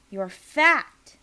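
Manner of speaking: sudden loud. Acted. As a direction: blurt it out loud. Quoted letter, sudden loud.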